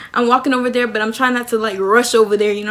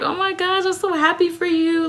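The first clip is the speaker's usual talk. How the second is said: high, gushing congratulations; hyping friend; 7 semitones higher than usual. Acted. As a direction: coo high with delight for her. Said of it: "they were just hyping me up".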